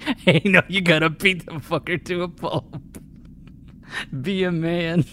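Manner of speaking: high, loud mock tough-guy advice; macho tough guy. Brash customer urges the groom, mock tough-guy voice. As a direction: bark it like a brash meathead.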